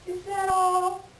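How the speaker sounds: slow hammed-up disbelief; mock incredulous. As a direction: scoff as if the offer is laughable. Coyote scoffs at Raven's offer.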